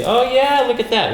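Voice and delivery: excited. Real, not acted. Excited at unboxed lens.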